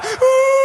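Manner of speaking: sudden high wail; high pitched alarmed wail; cry of alarm. Retold or imitated. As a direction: let out a startled cry. Sister starts crying, cries out.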